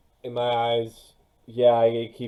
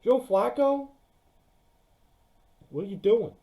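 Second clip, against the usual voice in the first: high disbelieving squeak; incredulous; 13 semitones higher, 3 dB louder than usual. Real, not acted. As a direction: ask it in total disbelief. Mocking Jets' quarterback choice.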